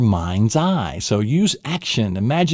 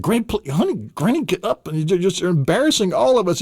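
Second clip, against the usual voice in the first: higher, flustered coaxing of granny; embarrassed pleading; 8 semitones higher than usual. Pretend. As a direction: beg her to get up, mildly mortified.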